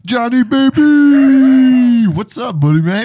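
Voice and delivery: drawn-out, loud, high hail, then high-pitched chummy patter; pushy sales rep. Pretend. Sales rep hailing a parts manager by phone, hammed-up chumminess. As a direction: greet him like an overfamiliar salesman, stretching the name out. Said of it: "you're the sales rep for sure".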